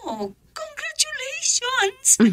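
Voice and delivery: thrilled high-pitched congratulations; excited congratulations. Acted. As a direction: squeal it out with delight. Friend hears of governor's honour.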